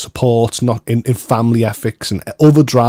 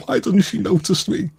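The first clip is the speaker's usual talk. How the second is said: mock crying whine; 8 semitones higher, 5 dB quieter than usual. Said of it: "but crying in the corner going".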